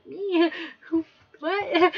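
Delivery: flustered surprise; confused surprise. Retold or imitated. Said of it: "She's like".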